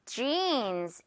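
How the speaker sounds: "jeans" with a long, stretched ee; English J, then hold the vowel of "jeans"; long drawn-out vowel. Shown as a demonstration. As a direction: drag out "jeans" slowly. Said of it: "이렇게 길게 끌어주세요".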